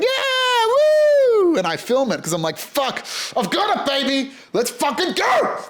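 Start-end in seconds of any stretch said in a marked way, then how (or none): 0.0-1.6 very high, loud mock cheer from the crowd; shrill imitated celebration whoop. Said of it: "celebrating and cheering and going". 2.6-5.6 high, hyped retold victory cry; pumped up. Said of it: "I'm pumped".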